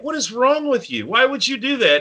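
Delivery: high, mock outraged scold; exasperated scolding. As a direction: scold the driver in disbelief. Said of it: "Todd's like".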